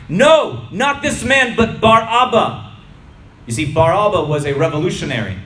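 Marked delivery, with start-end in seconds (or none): 0.1-2.7 high, loud crowd yell; loud shouted refusal, drawn out at the end; crowd yelling back. A narrator's reading. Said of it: "they yelled back".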